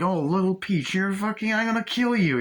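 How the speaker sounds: high-pitched acted-out villain threat; menacing threat. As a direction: threaten the victim, pitched well up. Acting out villain's threat to victim.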